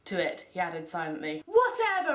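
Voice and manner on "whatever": high, sharp mock yell; angry yell. Ebony yelling back at Hagrid, hammed-up angry. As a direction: fling it back like a sulky teen. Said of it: "I yelled angrily".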